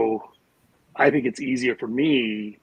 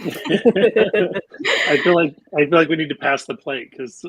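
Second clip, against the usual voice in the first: laughing through the words; giggling while she talks; 5 semitones higher, 7 dB louder, slower than usual. Real, not acted. Laughing while joking about collection.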